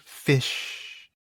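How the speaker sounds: ordinary delivery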